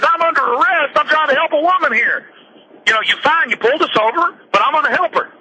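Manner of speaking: high-pitched, incredulous protest; exasperated protest. Retold or imitated. Retelling the EMT's plea to the arresting cop, high and incredulous. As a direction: imitate the EMT pleading high and disbelieving.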